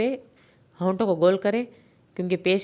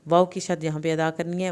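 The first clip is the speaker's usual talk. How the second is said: ordinary talk, nothing marked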